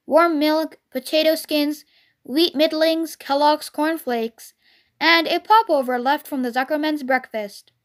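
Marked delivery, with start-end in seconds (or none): none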